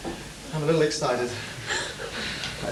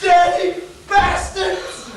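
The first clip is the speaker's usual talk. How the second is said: much higher, very slow shouted curse; husband screaming at the thieves; furious shout; 9 semitones higher, much slower than usual. Acted. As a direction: spit it out at the burglars in rage.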